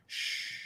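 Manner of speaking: soft, breathy shushing sound. Pretend. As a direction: hush someone gently with a long "shh". Pretends shushing someone at event.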